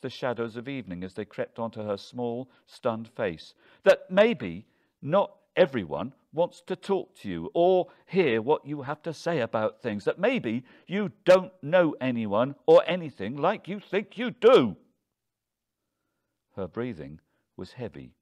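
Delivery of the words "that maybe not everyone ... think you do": loud, high rant building to a shout; heated, escalating rebuke; angry tirade. A narrator's reading. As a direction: let it all out at her, rising to a peak. Husband's tirade at stunned wife.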